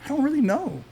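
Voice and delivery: high, hesitant voice; mock his own puzzled answer; unsure. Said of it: "And I was like".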